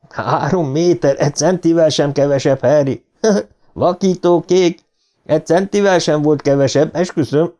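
high, excited bragging voice; gleeful boasting. A narrator's reading. Boy brags to Harry about the distance, gleeful.

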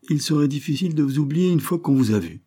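mocking gentleman pitched well up; insolent mockery. A narrator's reading. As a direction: sneer it with sly irony. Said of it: "Le ton et l'attitude étaient empreints d'une insolente moquerie".

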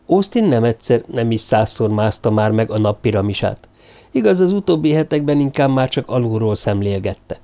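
ordinary delivery, nothing marked

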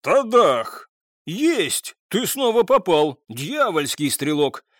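loud, high-pitched triumphant cheer; delighted yell. A narrator's reading. English lieutenant cheering a sharpshooter's hit, delighted yell. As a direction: crow over a perfect shot at full voice. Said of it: "Восторженно заорал английский лейтенант".

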